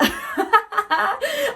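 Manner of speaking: loud squeal of a laugh; high bursting laugh. Real, not acted. Laughing at her own meme quote.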